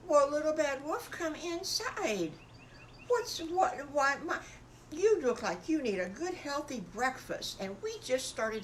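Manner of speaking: mother pig inviting the wolf in; welcoming mama. Acted. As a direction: fuss over the guest.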